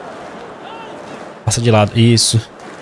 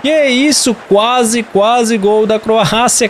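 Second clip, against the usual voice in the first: excited; 6 semitones higher, much slower than usual. Real, not acted. Near miss by the Croatians.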